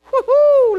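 very high, loud woo-hoo; exultant whoop. Real, not acted. Preacher celebrates the verse before the congregation, exultant whoop. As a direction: burst out with a joyful holler.

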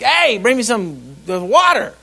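loud, high-pitched order to a waitress; imitating a blunt, drawn-out command; barked command. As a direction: demand it like a ranch boss giving orders. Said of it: "I use the command form to everything".

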